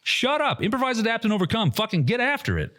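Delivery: high-pitched exasperated rant; retold frustrated rant.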